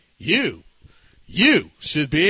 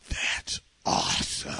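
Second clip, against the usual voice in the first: slow, breathed-out approval; awed whisper; 8 dB quieter, slower than usual. Retold or imitated. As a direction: let the words out in a long whisper. Patient won over, whispers.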